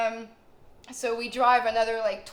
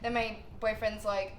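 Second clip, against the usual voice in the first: normal talking voice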